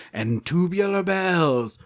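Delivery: slow infomercial voice-over; announcer voice. Retold or imitated. Podcaster imitating the infomercial for a CD, announcer voice. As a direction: announce the track like a TV ad. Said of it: "the announcer voice being like".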